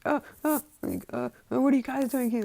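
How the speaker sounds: high, flustered stammer; startled awake. Acted. Todoroki wakes to find his family there, startled.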